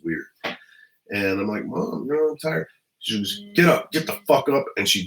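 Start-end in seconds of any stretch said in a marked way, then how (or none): 1.6-2.7 put-on high, small whiny child voice; sleepy four-year-old. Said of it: "I'm four years old". 3.5-4.6 higher, mock-furious rousting; mother's angry order. Mother rousting child at night.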